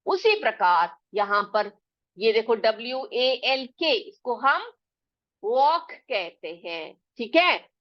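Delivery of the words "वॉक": "वॉक" slow and even, L silent; soft walk. Shown as a demonstration. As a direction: say "वॉक" gently, without force. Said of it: "बुरी तरह से जोर से हम बोलते नहीं है". ("वॉक" धीरे और नरमी से, एल चुप)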